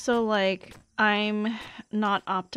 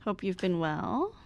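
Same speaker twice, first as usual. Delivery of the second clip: ordinary talk, nothing marked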